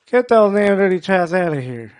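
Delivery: put-on Southern accent. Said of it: "once you attach a Southern accent to it".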